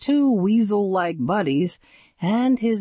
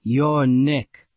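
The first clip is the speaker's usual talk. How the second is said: put-on low tough kid voice; 4 semitones lower than usual. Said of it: "voice was as low as a high schooler's".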